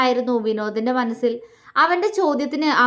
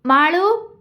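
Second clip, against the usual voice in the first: louder second call, high and insistent; calling again; 5 semitones higher, 4 dB louder, much slower than usual. A narrator's reading. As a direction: call her name once more from afar. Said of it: "അവൻ വീണ്ടും വിളിച്ചു". (വീണ്ടും ഉറക്കെ വിളിക്കുന്നു)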